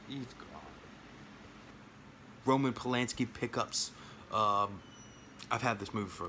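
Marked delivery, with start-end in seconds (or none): 0.1-0.5 barely audible, very soft reassurance; soothing. Real, not acted. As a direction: let it fade to a hush. Calming the dog down.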